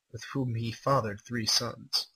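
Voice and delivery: normal talking voice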